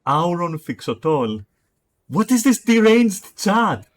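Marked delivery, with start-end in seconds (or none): 2.1-3.9 much higher, louder and slow, appalled; incredulous. Real, not acted. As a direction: stretch it out in loud disbelief. Reacting to weird chat.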